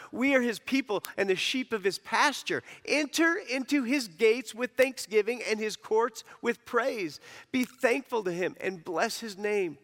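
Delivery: solemn preacher's scripture read. Acted. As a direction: proclaim the psalm to the congregation. Preacher reads psalm aloud.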